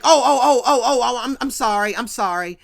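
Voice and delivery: loud burst of repeats, easing off; mock-startled, stumbling backpedal; flustered apology. [name] caught staring at Harriet, flustered mock apology.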